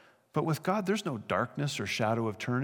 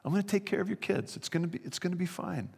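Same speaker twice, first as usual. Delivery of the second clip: gentle, higher and softer, a little quick; softly promising to care for them, higher voice; spoke kindly; 6 semitones higher, 4 dB quieter, faster than usual. Retold or imitated. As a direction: reassure frightened brothers tenderly. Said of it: "He spoke kindly to them".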